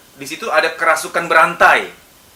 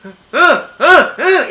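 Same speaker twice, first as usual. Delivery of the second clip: rapid high loud hehs piling up; mock angry shouts; 10 semitones higher, 7 dB louder, much slower than usual. Shown as a demonstration. Psychologist demonstrating pent-up yells merging into one. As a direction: let the angers pile together. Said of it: "ini digabung jadi satu".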